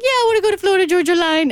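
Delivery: high, fast doggy voice. Retold or imitated. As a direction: answer in the pet dog voice. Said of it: "speaking like this with other people around".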